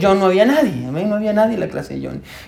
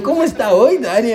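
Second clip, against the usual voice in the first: put-on higher, sweet little-boy voice; innocent child; 6 semitones higher than usual. A son hides his mischief from his father, higher sweet child voice. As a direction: play the innocent to distract dad. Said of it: "de que no me diera cuenta".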